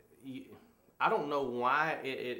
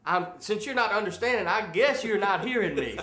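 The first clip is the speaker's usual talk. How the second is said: raised, higher voice of an escalating argument; getting louder; 6 semitones higher, 7 dB louder than usual. Shown as a demonstration. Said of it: "then it would get louder and louder".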